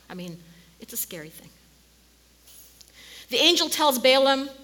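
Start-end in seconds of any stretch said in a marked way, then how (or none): 0.1-1.5 hushed whisper of warning; preacher whispers a warning to the congregation. Real, not acted. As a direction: whisper it like a grave secret.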